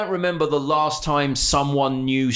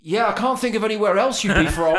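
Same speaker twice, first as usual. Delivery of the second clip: higher, mock-dim cashier voice through a laugh; 6 semitones higher than usual. Mocking the Starbucks woman's confident answer. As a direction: play the clueless drive-thru woman. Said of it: "And she went".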